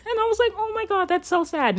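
high-pitched giggling disbelief; half laughing, half pitying squeal. Real, not acted. Laughing at the crying scene.

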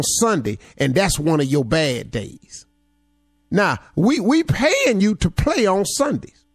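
fired-up motivational preaching. Acted. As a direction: build it like a sermon. Motivational speech preaching hard.

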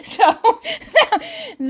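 laughing through the words, slow and high; laughing admission. Real, not acted. Vlogger laughing at herself about her posture, said through laughter. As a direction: giggle it out at your own expense.

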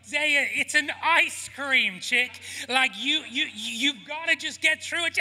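put-on high, loud pleading with a small child; retold parental protest, voice pushed way up; exasperated dad. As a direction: urge the child on, loudly and high. Father retelling his exasperated reply.

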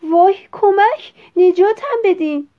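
high, loud panicked scream; acted alarm, loud and high-pitched; cry for help. A narrator's reading. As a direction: shriek to be rescued as you rise. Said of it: "فریاد زد".

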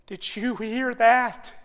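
slow, wide-eyed disbelief; astonished doctor. Retold or imitated. Doctor finding a heartbeat asks the father, astonished. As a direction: draw the question out in stunned wonder. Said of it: "His eyes got as big as saucers".

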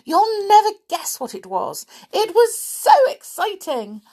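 high, breathless excited gush; Little Miss Chatterbox, very excited. Acted. Chatterbox telling her friend of an amazing sight, very excited. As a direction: pile it on, bursting to reach the big news. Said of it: "a very excited little Miss Chatterbox".

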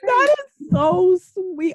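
slow, tearful and touched; near tears. Real, not acted. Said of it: "gonna make me cry".

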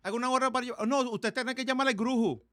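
loud, high Dominican Spanish; restaurant worker voice. Retold or imitated. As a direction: answer like a busy restaurant clerk. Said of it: "And they're like".

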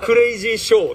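laughing mock foreign-accented クレイジーショー with the stress on レ; quoting girlfriend. Retelling girlfriend's verdict on Japanese videos, laughing mimicry. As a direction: toss it off as a mocking verdict. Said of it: "って言ってた".

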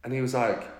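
ordinary delivery

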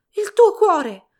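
high, very loud cry of fear; panicked shriek. A narrator's reading. Mother crying out about her daughter's heart, shrieking high and loud. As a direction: scream it out in terror for her. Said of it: "strillò".

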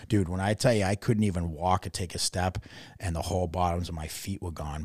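normal talking voice

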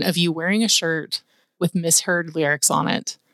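ordinary talk, nothing marked

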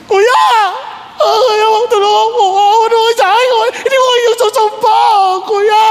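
high, wailing cries for big brother; hammed-up pleading shout, long drawn vowels; Joseph screaming. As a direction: cry out desperately from down the well. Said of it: "Siguro nagsisigaw si Joseph".

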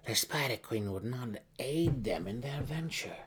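stubborn. A narrator's reading. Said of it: "Malice said stubbornly".

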